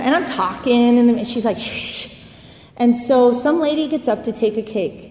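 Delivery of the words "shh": a quick unvoiced shh to hush someone; sharp little shush. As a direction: hush me like we're in a meeting. Friend hushing her in meeting.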